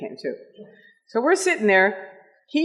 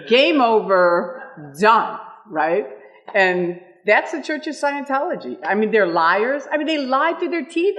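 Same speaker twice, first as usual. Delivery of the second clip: ordinary talk, nothing marked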